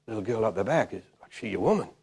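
low, soft, casual probing; discreet asking. Retold or imitated. Preacher asks man about the girl, discreetly hushed. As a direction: keep it confidential, just between the two of you.

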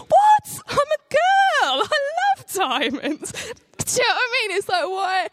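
put-on high, loud squealing girly voice; shrill-free high excited shriek, playful; mock girly squeal. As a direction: squeal it like a girl mad for diamonds. Girly outburst about loving diamonds.

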